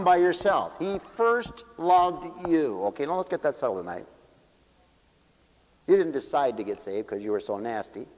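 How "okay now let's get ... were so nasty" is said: normal talking voice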